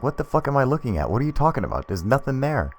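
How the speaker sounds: high-pitched mock bewilderment; exasperated disbelief. Man who can't see the light answers his friend, exasperated. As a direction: protest like you're baffled and fed up. Retold confused man arguing back.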